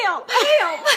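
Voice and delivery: high, loud laughing cry for help; mock panic on request. Shown as a demonstration. Asked by her mentor to repeat, a laughing mock panic. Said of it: "Do that again".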